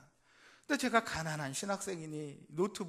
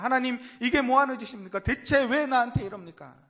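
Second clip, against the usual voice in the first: higher, louder acted rage, "이게" hit hard; furious protest; 7 semitones higher, 11 dB louder than usual. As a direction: Jonah yelling his outrage at God. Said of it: "화가 머리끝까지 올라서 하나님께 대들고 따지기".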